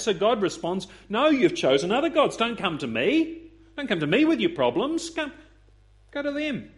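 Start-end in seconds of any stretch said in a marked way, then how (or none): 1.1-6.7 stern refusal. Acted. God answers Israel's plea.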